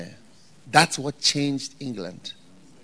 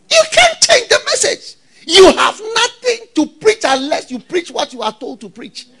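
high, loud insistent sermon; shouted preacher's admonition, easing slightly at the end; full-force preaching; 11 dB louder than usual. Acted. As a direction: drive the warning home at a shout.

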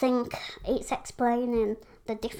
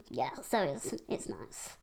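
usual speaking voice

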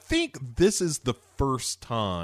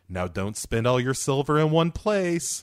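ordinary talk, nothing marked

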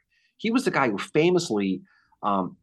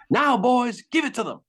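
mock Irish shout, pitched up; Irish accent yell; 12 semitones higher, 4 dB louder than usual. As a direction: yell it in a light Irish brogue. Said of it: "in his thick Irish accent".

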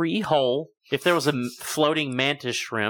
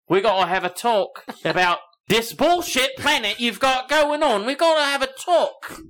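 Cockney rant rising much higher; Cockney accent; 8 semitones higher than usual. Acted. Alien complaining about this planet, escalating Cockney rant. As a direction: build to an exasperated Cockney squawk. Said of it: "came down and had a Cockney accent".

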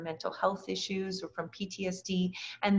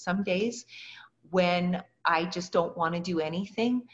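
ordinary talk

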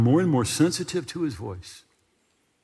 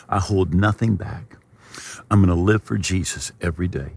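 lower, earnest prayer line by line; leading a prayer; 5 semitones lower than usual. Acted. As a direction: pray it aloud for them to repeat. Leads viewers in prayer.

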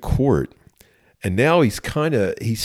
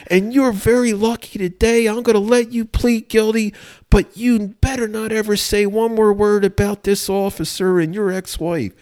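very high-pitched, outraged judge impression; screaming judge; 12 semitones higher than usual. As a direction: imitate an irate judge berating a defendant. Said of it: "he starts screaming at the, the defendant".

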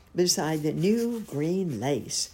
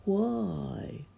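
higher, drawn-out sad pondering; a mournful question to oneself; sad musing; 7 semitones higher than usual. A narrator's reading. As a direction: wonder it sadly to yourself. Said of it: "he thought sadly to himself".

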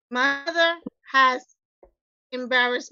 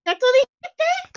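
mock mother scolding; 11 semitones higher, 5 dB louder than usual. Imitating her mother scolding.

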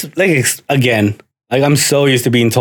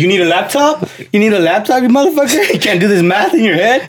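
mock exasperated dad; 7 semitones higher than usual. Dad mocking kid's math.

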